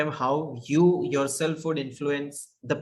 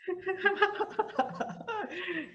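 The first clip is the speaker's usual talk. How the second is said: high burst of laughter; 7 dB quieter than usual. Real, not acted. Laughing at a provocative remark.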